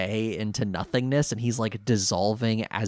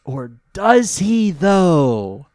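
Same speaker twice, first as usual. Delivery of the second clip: dramatic teasing; 6 semitones higher, 7 dB louder, much slower than usual. Acted. Echoes cliffhanger dramatically.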